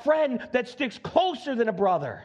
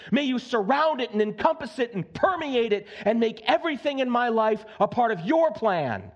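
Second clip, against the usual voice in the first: ordinary delivery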